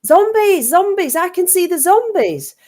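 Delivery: put-on high excited shout of a child spotting zombies; retold shout. Child in the playground shouting zombies to everyone. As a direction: call it out, high and thrilled. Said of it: "someone shouted".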